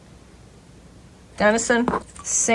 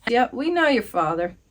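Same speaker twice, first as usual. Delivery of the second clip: ordinary talk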